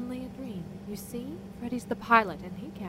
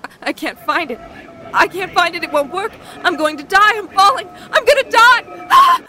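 from frightened cries building to a high, loud scream; panicked screaming; 9 semitones higher, 18 dB louder than usual. Acted. Susan screaming as her parachute fails, building panic. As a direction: terror rising as she falls.

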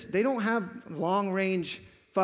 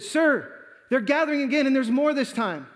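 raised, louder urgent report; calling out a report; 6 semitones higher, 4 dB louder than usual. Retold or imitated. Young lookout reporting to his commander, calling out. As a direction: call it out high to the officer. Boy lookout calls down to officer.

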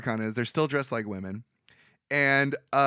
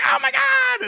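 high, louder gasp of shock; shocked cry; 6 dB louder, slower than usual. Retold or imitated. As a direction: squeal it in sudden panic. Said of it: "the leprechaun's like".

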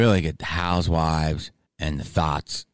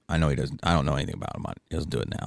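ordinary talk

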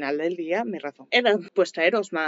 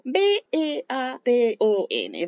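slow, higher, letter by letter; spelling out; 6 semitones higher, much slower than usual. Shown as a demonstration. Reviewer spelling the author's surname for viewers, slowly letter by letter. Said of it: "Si alguien sabe cómo se pronuncia".

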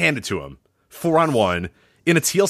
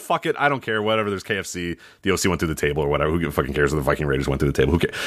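dismissive shrug; 11 semitones lower, faster than usual. Retold or imitated. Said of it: "just said".

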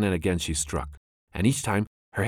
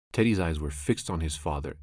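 ordinary delivery, nothing marked

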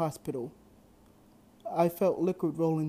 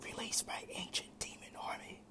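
soft, breathless whispering demon; demon king whisper; 14 dB quieter than usual. A narrator's reading. Demon king whispering inside Drake's head, menacing whisper. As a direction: hiss it like a voice inside his head.

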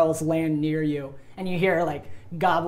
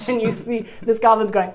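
usual speaking voice

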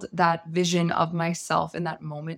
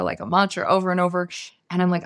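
normal talking voice

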